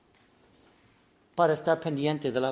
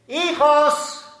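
llamada fuerte y resonante: "Hijos"; llama desde lo alto; Dios llamando; 10 semitones higher, 12 dB louder than usual. Retold or imitated. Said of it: "Cuando Dios dice". (Dios que llama a sus hijos, voz alta)

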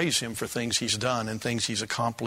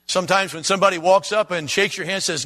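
normal talking voice

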